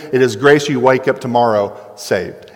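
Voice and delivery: normal talking voice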